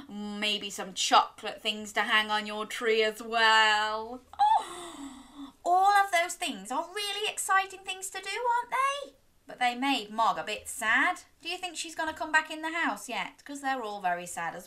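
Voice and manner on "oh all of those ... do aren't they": high, quick excited voice. Acted. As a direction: bubble over with excitement. Excited over decorations.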